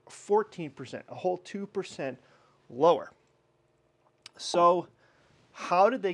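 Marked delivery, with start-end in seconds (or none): none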